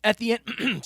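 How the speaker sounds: throat catching mid-sentence; choking. Real, not acted. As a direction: speak through a tickle in the throat. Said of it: "sorry, I'm choking here".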